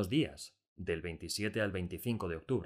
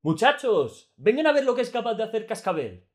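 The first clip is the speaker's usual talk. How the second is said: much higher and louder, shouted call; shouting; 14 semitones higher, 12 dB louder than usual. A narrator's reading. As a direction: call the guards over at full voice. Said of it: "Aún me parecía oír a Delacroix gritar".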